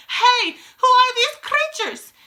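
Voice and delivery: very high, loud outrage; frantic teacher yelling; demented yelling. A narrator's reading. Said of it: "demented teachers is yelling".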